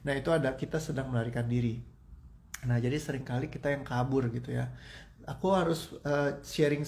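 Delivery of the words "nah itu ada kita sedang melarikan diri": ordinary talk, nothing marked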